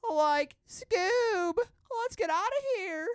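very high, loud mock-panicked cartoon voice; cartoon impression. Friend plays a cartoon getaway, high and loud. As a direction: squeal it like a cartoon coward fleeing.

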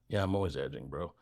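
low, quiet bro aside; casual bro quote. Acted. Voicing what others would say.

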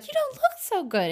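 high-pitched mock-concerned voice; high, affected remark; mimicking Alice. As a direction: lift it high, imitating an oblivious mother. Alice greets sick Betty, mimicked.

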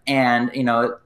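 ordinary talk

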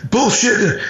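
high-pitched heckler's dismissal; mock scoffing objection. Mocking a doubter's interjection.

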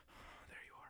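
breathy weak whisper; faking frailty. Pretend. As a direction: whisper like you're too sick. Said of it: "pretending to be sick".

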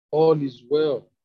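loud, drawn-out narrated call; crying out. A narrator's reading. Messenger hailing the king with good news, crying out. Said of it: "Then Ahimaaz cried out".

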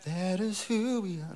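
lifted, call for response. Shown as a demonstration. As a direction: sing it up high so they answer back.

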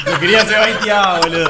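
words through a laugh. Real, not acted. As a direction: laugh while saying it. Laughing at the drink joke. (riéndose)

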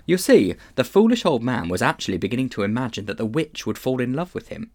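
ordinary delivery, nothing marked

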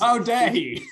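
high indignant quoting of the critic; mock outrage. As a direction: toss it off like an offended critic. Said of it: "How dare he go into these flights".

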